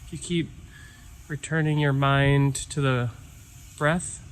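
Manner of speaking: calm meditation guide with long pauses; guided meditation. Real, not acted. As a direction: ease listeners inward, leaving silence between phrases. Leads a breathing meditation.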